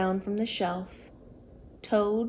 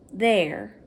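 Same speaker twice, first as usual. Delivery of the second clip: usual speaking voice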